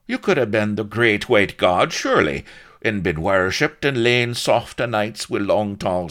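high, loud, insistent dialect voice; suspicious interrogator. A narrator's reading. Blood corners Slippery Sol, loud suspicious needling. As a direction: press him until he cracks.

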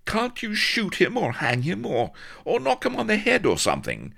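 high-pitched exasperated plea; pleading lady, high and hurrying at the end; crying out. A narrator's reading. Lady begging pirates to finish him off, high exasperated cry. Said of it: "she cried".

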